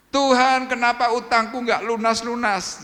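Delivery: high, loud, slow whining to God; mock the grumbler's wail; whining complaint. (meniru keluhan merengek dengan suara tinggi)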